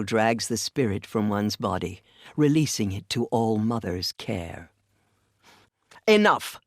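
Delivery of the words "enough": sharp rebuke. Acted. Cutting off a quarrel.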